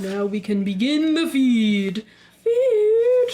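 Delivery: slow gloating, then a loud high "Feed"; greedy walrus voice. Pretend. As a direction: savour the feast, then shout it.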